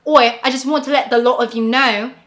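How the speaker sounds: loud cockney Oi, British accent put on; bad British accent. American vlogger attempting a British sentence, bad British accent. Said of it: "my perfect British sentence".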